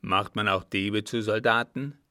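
loud, scornful challenge; irate old man; indignant old captain. A narrator's reading. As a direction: throw the question out angrily, at full voice. Angry old captain challenges his servant.

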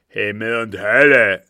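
furious, very loud outburst; enraged cry. A narrator's reading. Captain reading the note, enraged cry. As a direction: bellow it like an angered beast. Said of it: "ließ ein Schnaufen hören, wie von einem Tiere, welches in Zorn geraten ist".

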